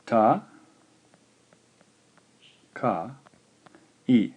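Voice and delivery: "Ta, ka, i" spaced out slowly, each syllable separate. Shown as a demonstration. Teacher repeats the answer slowly for students: spaced syllables. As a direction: break it into syllables. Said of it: "slowly in Japanese".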